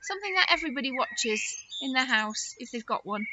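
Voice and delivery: normal talking voice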